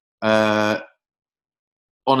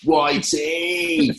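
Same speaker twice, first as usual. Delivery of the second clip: mock Clive doing the cool handshake greeting; cool greeting, higher; 7 semitones higher than usual. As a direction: stretch Whitey out high and rising like a cool mate. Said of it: "went like this".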